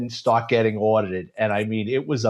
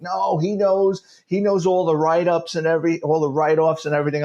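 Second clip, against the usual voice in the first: eager; 6 semitones higher than usual. Retold or imitated. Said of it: "And I'm like".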